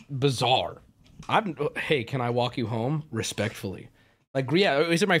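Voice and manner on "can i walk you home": ordinary delivery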